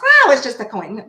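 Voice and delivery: loud mock skeptic, leaping on "Oh"; mocking imitation. Reader mimicking people who live in a box, mocking. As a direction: parody the excuse loudly.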